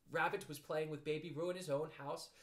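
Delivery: ordinary delivery, nothing marked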